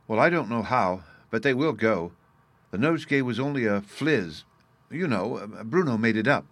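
ordinary delivery, nothing marked

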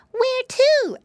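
put-on very high, loud child's question; eager little piggy brother. A narrator's reading. Piggy brother asks rabbit uncle about the trip, eager. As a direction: pipe up eagerly.

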